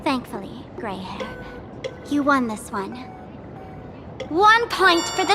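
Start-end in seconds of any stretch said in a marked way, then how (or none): 0.8-1.4 soft whispered aside. Acted. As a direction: whisper the nickname.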